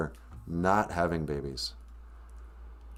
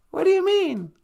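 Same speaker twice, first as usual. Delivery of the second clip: very high squeaky question; puzzled sidekick character. Acted. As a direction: ask it in a tiny puppet voice.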